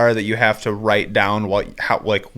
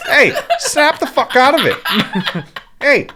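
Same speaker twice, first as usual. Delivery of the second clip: high, laughing mock scolding; mock yell; 9 semitones higher than usual. Friend telling happy guy to snap out of it, mock yell. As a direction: bark at him half laughing.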